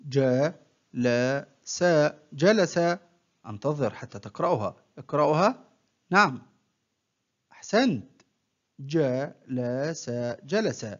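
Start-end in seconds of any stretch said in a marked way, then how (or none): 0.1-1.8 one short syllable at a time, with pauses; broken into syllables. Shown as a demonstration. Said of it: "أقطع الكلمة إلى مقاطع قصيرة". 8.8-10.1 one short syllable at a time, with pauses; broken into syllables. Shown as a demonstration. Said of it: "أقطع الكلمة إلى مقاطع قصيرة".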